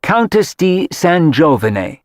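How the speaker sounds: put-on slow, Italian-accented title. A narrator's reading. Mother corrects the chef with her Italian title, in an Italian accent. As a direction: roll out the name in Italian, slowly. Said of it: "in the accent her late husband had taught her".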